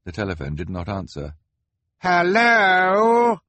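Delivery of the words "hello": high, loud calls into the silent line; calling as loud as she dared. A narrator's reading. Violet calling into the dead phone, loud and high. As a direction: call out as loud as you dare. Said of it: "as loud as she dared".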